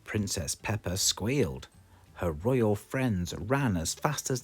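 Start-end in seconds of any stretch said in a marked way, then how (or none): none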